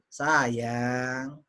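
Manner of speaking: low, lingering sayang; affectionate intonation. Shown as a demonstration. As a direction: stretch sayang tenderly like an endearment.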